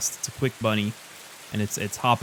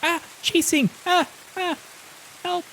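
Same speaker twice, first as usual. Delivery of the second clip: panicked squeak. Acted. GM voices fleeing bunny.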